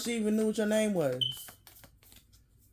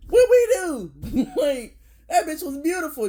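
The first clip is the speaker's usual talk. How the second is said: higher, louder mock-indignant "What we do?"; mock outrage; 7 semitones higher, 6 dB louder than usual. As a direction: cry out in hammed-up disbelief.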